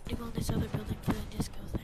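fast hushed whisper. Real, not acted. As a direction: whisper it quickly so nobody wakes. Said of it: "Why is he whispering?".

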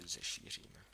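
"Zešíříme" breathed low and unvoiced, tasting the word; puzzled whisper over an odd word; musing whisper. Shown as a demonstration. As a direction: whisper "Zešíříme" doubtfully to yourself. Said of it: "Taky dost divný slovo".

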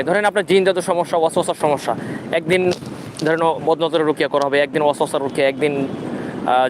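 normal talking voice

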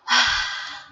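heavy acted sigh. Acts out taking a deep breath.

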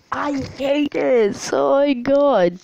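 slow, loud drawn-out wail; panicked wail. Real, not acted. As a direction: cry out in dread. Fleeing sheep in panic.

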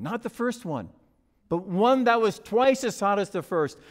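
usual speaking voice

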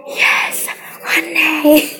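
hushed, breathy whisper. Acted. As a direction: whisper it like a secret. Excited whisper about the pay raise.

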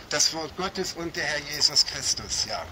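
ordinary talk

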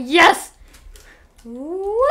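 loud high whoop; victory cheer. Real, not acted. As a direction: celebrate the win.

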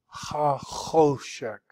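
"Ha Choshek", plain H then throaty Ch; slowly, "Ha" breathed, "Choshek" opening on a hard H. Shown as a demonstration. Teacher contrasting two Hebrew letters, hard H in "Choshek". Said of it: "H and then the hard H".

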